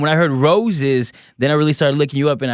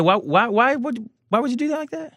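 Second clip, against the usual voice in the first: incredulous; 11 semitones higher than usual. Real, not acted. Incredulous high question.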